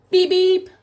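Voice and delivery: high, loud mock car horn; beep beep. Teacher honking for the kids, playful horn. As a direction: honk it like a toy Jeep.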